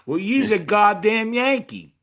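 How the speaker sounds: loud mock Southern drawl; hammed-up Southern accent. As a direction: sneer it like a Southern local. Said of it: "southern drawl and the southern accent".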